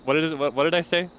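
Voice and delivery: ordinary talk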